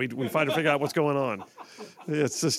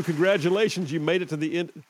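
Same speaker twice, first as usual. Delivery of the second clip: ordinary talk, nothing marked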